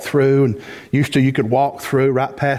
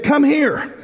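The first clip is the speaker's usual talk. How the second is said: inviting call; 12 semitones higher than usual. Shown as a demonstration. Boaz calling Ruth to eat, high inviting call. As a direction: Come here. lifted much higher, like calling her over. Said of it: "But he said".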